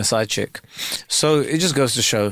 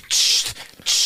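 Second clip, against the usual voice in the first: voiceless double shush; sharp hissed "shh", twice; imitated shushing. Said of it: "you came in and you were like".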